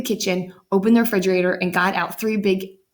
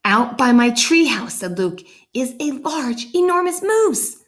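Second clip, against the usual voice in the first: put-on excited boy; 6 semitones higher, 3 dB louder than usual. Excited boy reports moose.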